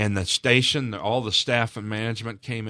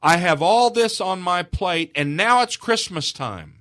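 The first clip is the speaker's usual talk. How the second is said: high, loud, drawn-out exasperation; retold upset outburst, stretched and raised; upset mother; 8 semitones higher, 6 dB louder, slower than usual. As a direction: lay it on the kids slowly and forcefully, as their overwhelmed mother. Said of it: "I got upset with them".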